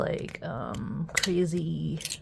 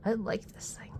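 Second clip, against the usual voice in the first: whisper; 4 semitones higher, 3 dB quieter, faster than usual. Real, not acted. Whispered approval.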